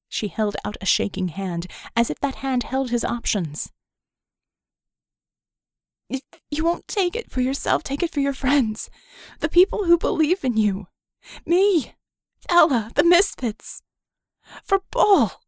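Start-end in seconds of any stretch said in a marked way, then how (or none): 6.1-15.4 pleading that climbs higher and louder until it breaks; voice cracking. Acted. Vicky begging Red for his friends' sake, voice breaking. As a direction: build the plea up until the voice cracks on the last name. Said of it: "Her voice cracked and broke".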